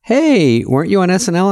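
high-pitched imitated fan greeting; excited fan. Fan spotting a comedian outside the sandwich shop, high mock excitement. Voicing a fan who approaches him.

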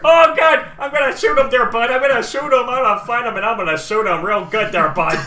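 high, loud panicked hollering; hammed-up trigger-happy guard; frantic threat. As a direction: yell blustering threats, easing slightly. Guard panics, threatens to shoot blindly.

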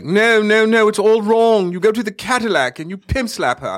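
high, loud mock director voice; hammed-up clueless director barking notes.

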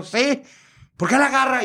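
high, put-on exchange; camp phone voices. Caller and performer discuss the nickname, high camp voices. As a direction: toss it back in a high campy voice.

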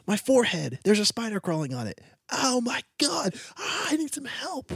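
panicked whine. Pretend. Walker panicking about a spider crawling on the forehead, high wail. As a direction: freak out over a spider on your forehead. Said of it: "imagine you're walking and then you go".

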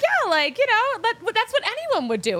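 breezy high shrug of a voice; imitating the shop owner. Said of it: "She was like".

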